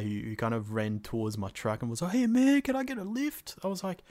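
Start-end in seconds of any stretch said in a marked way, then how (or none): 2.1-4.0 high mock bloke voice; character voice. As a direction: call out for a lift, pitched up. Hitchhiker dialogue voiced.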